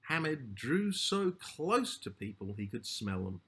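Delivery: ordinary delivery, nothing marked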